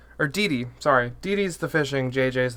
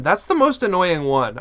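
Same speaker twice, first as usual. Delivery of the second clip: normal talking voice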